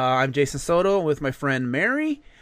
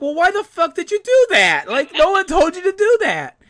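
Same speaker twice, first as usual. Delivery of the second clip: high, loud, exasperated yell at the goose; mock scolding; 12 semitones higher, 7 dB louder than usual. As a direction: scold the dumb goose as if it chose to do this. Scolding the goose that hit car.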